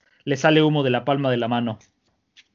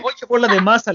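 high, loud yell across the field; loud, high-pitched call; Luffy yelling; 7 semitones higher, 6 dB louder than usual. Acted. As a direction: shout it out to get his attention. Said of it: "le grita Luffy".

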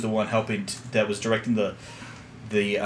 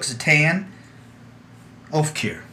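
put-on southern accent; 4 semitones higher, slower than usual. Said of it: "Arabic names in a southern accent".

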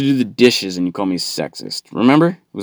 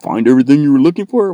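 high, loud, rushed stock question; imitated store clerk; 6 semitones higher, 6 dB louder, faster than usual. Clerk quizzes customer at checkout, fast mock greeting. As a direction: rattle it off brightly, mock helpful. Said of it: "they always say that".

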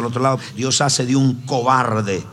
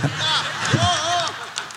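mock yelp; 4 dB quieter than usual. Thrown over the desk.

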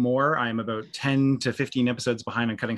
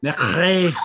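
"McCrae" rolled with more phlegm in the throat; phlegmy Scottish accent; 4 semitones higher, 6 dB louder than usual. Shown as a demonstration. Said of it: "It needs more phlegm".

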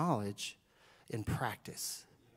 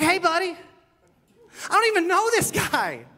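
much higher and louder, slow, outraged yell; yelling at a ref; 13 semitones higher, 8 dB louder, slower than usual. Retold or imitated. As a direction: shout at the ref across the court. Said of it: "I was like".

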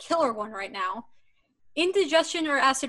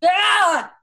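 frustrated shout of stress; stressed cry; 7 semitones higher, 9 dB louder than usual. Real, not acted. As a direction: let out a strained cry. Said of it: "and I am just".

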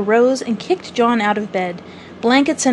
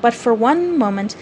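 ordinary delivery, nothing marked

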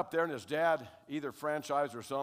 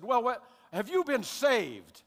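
slow, drawn-out, high and louder church question; churchy deacon; 9 semitones higher, 6 dB louder, slower than usual. Retold or imitated. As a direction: stretch every word like a solemn deacon. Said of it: "he does it this way".

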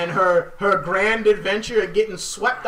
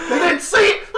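high laughing taunting ooh; mocking chat; 8 semitones higher, 3 dB louder than usual. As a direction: tease them with a giggly ooh. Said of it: "just like".

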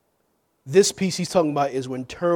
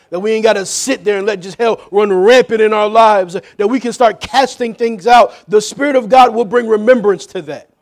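raised, driving preacher's voice; preach; 6 semitones higher, 11 dB louder than usual. Real, not acted. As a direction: press it on the congregation with rising force. Preaches at full force.

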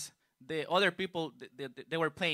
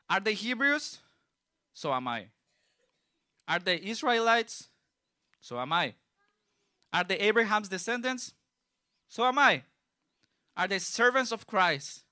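rising, louder rhetorical questions read from scripture; building preaching; 3 semitones higher, 4 dB louder than usual. Acted. Preacher reading Paul's boasts to the congregation, building louder. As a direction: build each question, answer it flatly.

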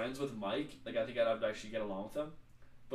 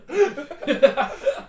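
high loud burst of laughter; 14 semitones higher, 14 dB louder than usual. Real, not acted. Friend reads a crude quote, a loud laugh. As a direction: crack up loudly.

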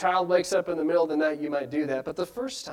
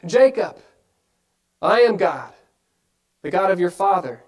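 voice of God; 5 dB louder than usual. Acted.

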